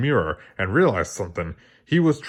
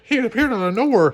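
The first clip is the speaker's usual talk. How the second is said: "He appeared out of nowhere" pitched much higher, as the clerk; 11 semitones higher, faster than usual. Shown as a demonstration.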